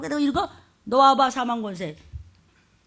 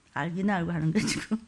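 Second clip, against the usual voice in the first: normal talking voice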